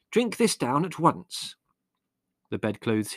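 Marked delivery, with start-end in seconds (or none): 0.1-1.6 high, measured command; firm motherly order, lightly scolding; brisk snap. A narrator's reading. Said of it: "she snapped briskly".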